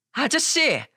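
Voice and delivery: sharp, high-pitched angry cry; angry outburst. A narrator's reading. Daughter-in-law cuts off the driver's revelation, angry outburst. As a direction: burst out angrily to silence someone. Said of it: "버럭 화를 냈어요".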